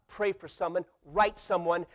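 ordinary delivery

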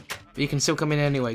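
ordinary talk

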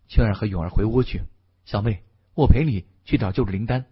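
usual speaking voice

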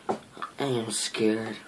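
ordinary delivery

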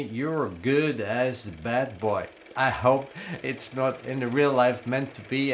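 ordinary talk

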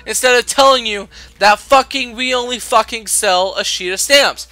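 high, loud, building rant; furious rant. Real, not acted. Cashier ranting at the rude stamp customer, furious. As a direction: vent every word at her.

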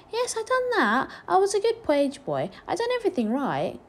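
put-on high-pitched eager child; proud little boy. A narrator's reading. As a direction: boast innocently as a small boy.

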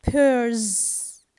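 drawn-out "Pears" with a voiced z ending; final S as Z. Shown as a demonstration. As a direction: buzz the end of "Pears". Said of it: "I pronounce the S".

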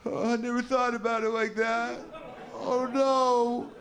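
mock-devastated high, slow voice, hope drained away; crushed believer. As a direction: let all hope drain out, slowly. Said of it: "the guy just goes".

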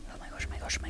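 barely audible frantic whisper; panicked whisper. Real, not acted. Gamer hiding from the beast, panicked whisper.

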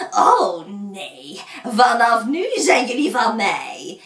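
put-on loud, croaky old witch; croaking witch. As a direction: rasp it out menacingly. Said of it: "Maar het oudje kraste".

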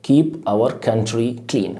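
slow, words spaced out; slow teacher repetition; slow drill. Shown as a demonstration. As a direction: drill it slowly for students. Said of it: "teacher drilling the phrase".

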